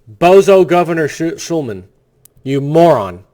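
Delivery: loud, contemptuous insult hurled at the governor; angry outburst. Real, not acted. Radio host yelling at the governor, angry and loud. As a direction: bark the insult with full scorn.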